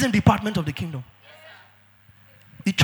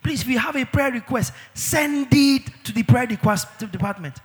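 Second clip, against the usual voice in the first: usual speaking voice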